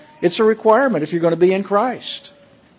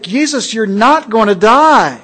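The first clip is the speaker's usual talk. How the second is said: loud, high pleading no; emphatic protest; 4 semitones higher, 6 dB louder than usual. Acted. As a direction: refuse him outright, rising to a cry. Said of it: "Peter was emphatic".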